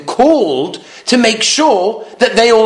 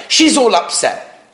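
ordinary talk, nothing marked

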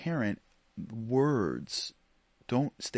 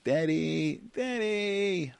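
high, routine nagging "Daddy"; mock-child pestering without real feeling; emotionless child's call; 10 semitones higher, 4 dB louder, much slower than usual. Shown as a demonstration. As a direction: repeat it by rote in a kid voice, no urgency. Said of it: "words that I say are meaningless and emotionless".

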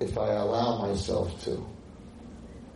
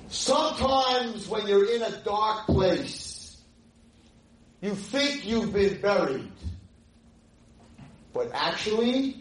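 high, slow, raised-voice declamation; impassioned quote; 11 semitones higher, 4 dB louder, slower than usual. Retold or imitated. Rabbi delivering a student's last line to audience, impassioned. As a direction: call it out slowly, pitched far up.

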